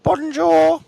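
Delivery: loud, high "Bonjour"; called-out greeting. Real, not acted. Host calls out a French greeting in the street, loud and high. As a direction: sing out a hello, high and loud.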